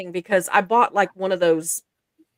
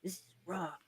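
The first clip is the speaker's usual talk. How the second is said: tough guy voice; 3 semitones lower, 11 dB quieter than usual. Retold or imitated. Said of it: "his tough guy voice".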